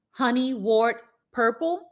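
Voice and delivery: usual speaking voice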